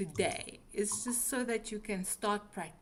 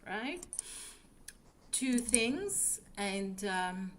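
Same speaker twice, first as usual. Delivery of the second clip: usual speaking voice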